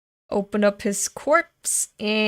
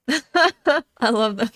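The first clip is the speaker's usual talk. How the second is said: slow, louder, with a laugh; laughing delight; 3 semitones higher, 5 dB louder, much slower than usual. Real, not acted. Reader laughing with delight at the characters. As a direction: laugh out your love for them.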